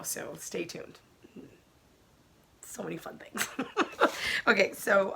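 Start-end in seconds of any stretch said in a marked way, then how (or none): 0.0-3.5 secretive little whisper. Real, not acted. Teasing a secret announcement. 3.5-4.4 breathy high-pitched giggle. Real, not acted. Giggle after whispered tease.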